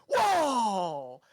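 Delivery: long, falling whoa of awe; loud, drawn-out gasp of wonder; amazed crowd. Acted. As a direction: stretch it out as a wowed audience.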